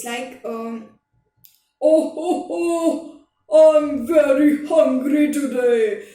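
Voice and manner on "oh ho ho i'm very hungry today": big, booming heavy person, loud and drawn out; heavy stomach voice. Shown as a demonstration. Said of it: "you can use your stomach voice".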